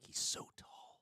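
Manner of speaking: breathy, voiceless aside "He's so tall."; mock whisper. Host recalling first meeting Eric, mock whispered. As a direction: whisper it like a private thought.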